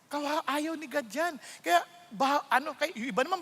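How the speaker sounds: usual speaking voice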